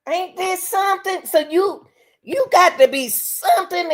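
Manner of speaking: incredulous. Real, not acted. Disbelief at the story.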